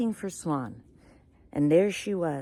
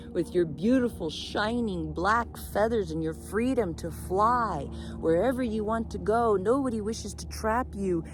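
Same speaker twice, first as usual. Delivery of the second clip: higher, louder, glowing praise; fervent admiration; 6 semitones higher, 4 dB louder than usual. Acted. Peacock envies raven's freedom to fly, fervent admiration. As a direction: build the praise to a ringing finish.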